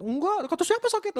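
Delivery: high, loud mock protest; emphatic denial. Recovered mother brushes off concern.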